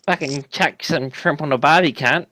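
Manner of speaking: hammed-up Aussie twang, lightly done; jokey Australian accent, only half committed; mock Australian accent. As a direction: toss off a stock Australian line in a put-on Aussie accent. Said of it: "I thought that was Australian".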